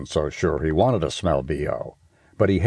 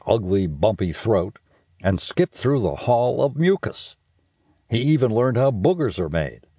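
plain reading voice

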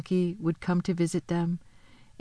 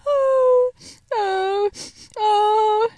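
high, wailing sobs; acted sobs; 13 semitones higher, 6 dB louder, much slower than usual. A narrator's reading. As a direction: wail three loud, broken sobs. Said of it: "sobbed Mister Tumnus".

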